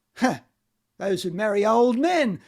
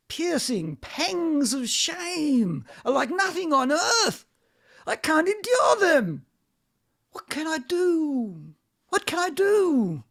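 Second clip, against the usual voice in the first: rising, tearful cry of shame; anguished cry; 5 semitones higher than usual. Acted. Vanya pleading with Astrov in shame, crying out. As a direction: break down under the shame.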